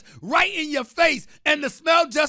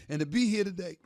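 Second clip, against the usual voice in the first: mock emotional; 12 semitones lower, 14 dB quieter, much faster than usual. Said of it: "I'm getting emotional right now".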